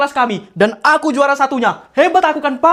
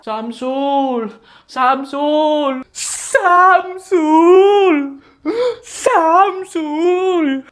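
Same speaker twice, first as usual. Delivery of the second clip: calling from far off, growing tearful; distant calling; 2 semitones higher, much slower than usual. Acted. Helper searching for the lost boy, calling from a distance. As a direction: call out, then let it crack.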